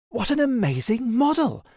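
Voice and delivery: ordinary delivery, nothing marked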